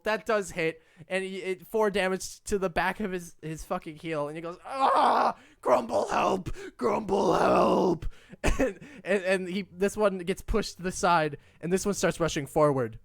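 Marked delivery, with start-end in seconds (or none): 4.6-8.1 panicked troll cry. Acted. Wounded troll calls for help.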